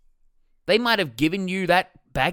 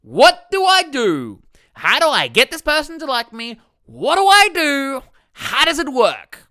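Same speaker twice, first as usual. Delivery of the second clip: mock panicked asking; 7 semitones higher, 7 dB louder than usual. Coach mimicking anxious people's thoughts, high loud pleading. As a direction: ham up the desperate questions. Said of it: "everyone always thinks".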